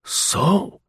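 high, rising, relieved exclamation; vibrant relief. Acted. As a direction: let relief lift the word. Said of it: "his tone was vibrant with relief".

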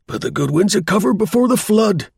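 high-pitched call over a distance; shouting. A narrator's reading. Dundas warns the stranded German from the boat, shouting. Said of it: "shouted Dundas again".

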